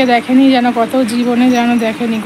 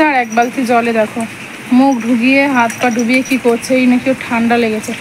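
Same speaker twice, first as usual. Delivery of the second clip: ordinary delivery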